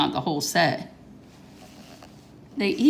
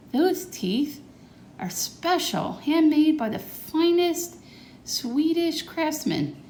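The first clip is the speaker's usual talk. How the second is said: talking funny; 10 semitones higher, slower than usual. Acted. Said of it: "Why is Grandpa talking funny?".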